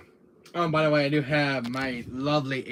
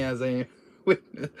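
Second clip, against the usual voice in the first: ordinary talk, nothing marked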